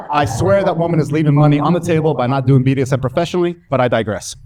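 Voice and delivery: normal talking voice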